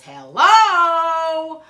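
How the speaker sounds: loud, high, drawn-out HELLO; mock-furious bellow of a greeting; ballistic dad. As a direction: belt it out, holding the last vowel long. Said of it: "The man went ballistic".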